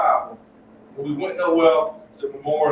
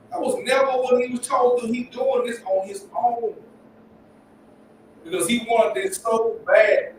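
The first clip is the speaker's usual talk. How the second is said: fired-up preaching; 5 semitones higher than usual. Acted. Preaching run, raised pitch.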